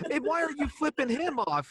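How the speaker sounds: incredulous high exclamation. Real, not acted. Incredulous reaction to movie scene.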